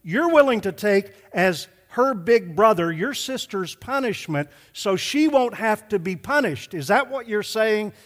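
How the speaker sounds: emphatic father spelling out the offer; heavy emphasis. Retold or imitated. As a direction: punch each key phrase for the listeners. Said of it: "I was trying to emphasize this".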